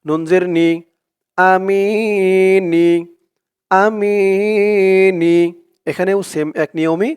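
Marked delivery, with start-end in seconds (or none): 1.4-5.4 Quran recitation. Shown as a demonstration. Teacher reciting for viewers to repeat, slow tajweed chant. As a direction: recite it slowly so students can follow. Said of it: "recitation demo".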